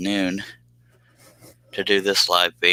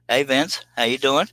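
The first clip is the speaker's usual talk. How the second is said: put-on greeting; 6 semitones higher than usual.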